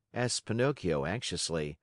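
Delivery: ordinary delivery, nothing marked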